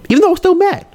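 very high, squeaky confession; protesting aside. Acted. Man admitting he was faking being over it, high protesting aside. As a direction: shoot way up in pitch for the aside. Aside undercutting a claimed calm.

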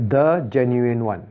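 slow, weighty "the" before "genuine one"; emphasis on THE. Shown as a demonstration. Teacher showing the class the emphasis, stressed THE. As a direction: stretch and stress "the". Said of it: "that is the emphasis".

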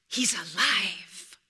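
slow, voiceless hush; secretive whisper of wonder. Acted. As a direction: share it like a secret. Rafiki revealing secret.

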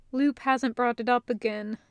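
ordinary delivery

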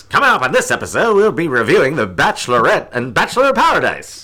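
put-on high, punchy old-time radio announcer; forties announcer voice. Shown as a demonstration. Previewing the episode on a podcast, forties announcer voice. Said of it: "Can I do my forties voice?".

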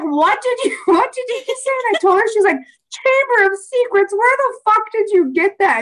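high, laughing what did you say; mom laughing in disbelief. Retold or imitated. Mom hearing her daughter's phrase, high laughing disbelief. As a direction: play mom losing it with laughter. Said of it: "she was like".

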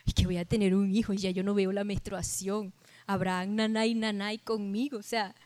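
low, hushed mutter to herself; doubting under her breath, very soft; mock incredulous muttering, low and quiet; Sara's private thought. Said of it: "pensó ella".

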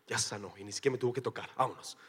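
soft, fast muttered aside; quiet quick aside. Retold or imitated. Jesus slipping away from the healed woman, quick quiet aside. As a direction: rattle it off under your breath.

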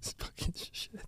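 whispered curse through giggles; trying not to laugh. Real, not acted. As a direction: hiss it while fighting laughter.

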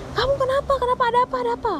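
high, urgent string of questions; worried, high-pitched retold questioning; acted alarm. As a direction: imitate alarmed friends rushing over.

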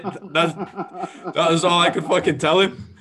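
high laughing finish; cracking up at his own story. Real, not acted. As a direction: laugh through the punchline.

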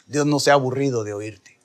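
ordinary delivery

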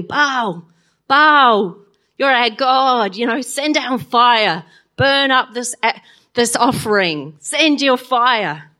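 loud, slow pleading cry to Baal; calling out to Baal. Retold or imitated. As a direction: plead loudly and slowly for fire.

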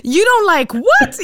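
high, loud, slow what?; hammed-up shocked teacher; mock disbelief. Teacher mocking a student's prejudice, in high disbelief.

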